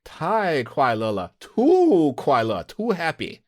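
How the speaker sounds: high, drawn-out mock delight; exaggerated delight. Host echoes co-host's answer, exaggerated delight. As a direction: stretch the words out and send the pitch up.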